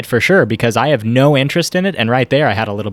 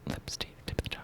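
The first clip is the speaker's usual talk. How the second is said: whispering; 23 dB quieter, faster than usual. Pretend. Whispering ASMR cues.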